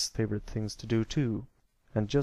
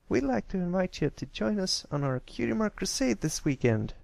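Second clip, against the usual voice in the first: cheerful filly voice pitched higher; bright country filly making an invitation, higher; Apple Bloom's voice; 9 semitones higher than usual. Acted. Filly invites the shy colt along, higher filly voice.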